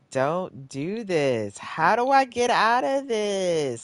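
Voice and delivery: loud, rising exasperated wail. Real, not acted. Player begging the stuck game, exasperated. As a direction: build from slow plea to high, loud frustration.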